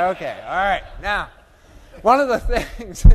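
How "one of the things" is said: breaking into a laugh mid-phrase; higher and louder, laughing through it. Real, not acted. Laughs mid-phrase during talk.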